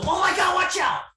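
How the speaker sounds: high, loud panicked shout of warning; panicked yell. Retold or imitated. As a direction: yell it like the car is about to crash. Retold panicked crash warning.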